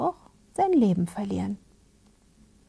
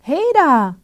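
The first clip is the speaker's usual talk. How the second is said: raised, higher storybook call; 7 semitones higher, 7 dB louder than usual. A narrator's reading. Soldier hails the man in the tree, calling out. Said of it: "rief der Soldat".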